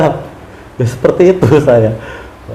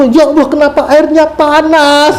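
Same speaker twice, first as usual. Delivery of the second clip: higher, slower, bewildered ya Allah; puzzled complaint; 9 semitones higher than usual. Retold or imitated. Thirsty pilgrim finding mosque tap water hot, bewildered. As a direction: ask in disbelief why it burns. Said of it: "Saya bilang gitu".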